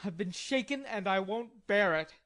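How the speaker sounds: a hint of a sulky growl; indignant growl. A narrator's reading. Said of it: "growled Laurie indignantly".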